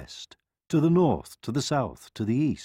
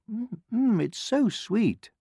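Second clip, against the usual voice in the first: savouring; 11 semitones higher than usual. A narrator's reading. Courtiers savour the sip.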